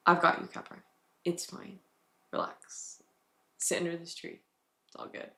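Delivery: very soft, hushed, comforting voice; gentle reassurance. Retold or imitated. Holy Spirit comforting her under the tree, gently hushed. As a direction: drop right down to a soothing near-whisper. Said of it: "the Holy Spirit was just giving me a hug".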